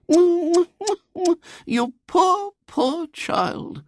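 very high, gushing sympathy; a lady overcome with pity. A narrator's reading. Lady hears both parents drink, gushing pity.

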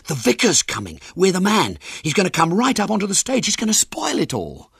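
high, urgent warning in full voice; alarmed stage whisper. A narrator's reading. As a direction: panicky lookout's warning, pitched high. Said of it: "whispered Ginger, looking at the open door".

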